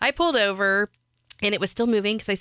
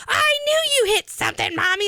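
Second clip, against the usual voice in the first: put-on very high, sing-song kid taunt; teasing child voice. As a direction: needle mom like a smug kid. Mimicking son's teasing.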